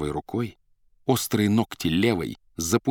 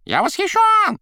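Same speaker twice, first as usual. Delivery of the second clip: very high, loud squeal; вскрик кота; 7 dB louder than usual. Acted. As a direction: squeal out with delight. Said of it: "вскрикивал кот". (громким высоким вскриком)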